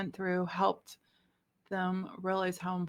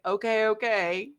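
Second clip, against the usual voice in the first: grudging, louder give-in; resistant; 5 dB louder than usual. Retold or imitated. To Spirit on being told to forgive, resistant. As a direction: act out reluctantly giving in. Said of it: "like, resistant and".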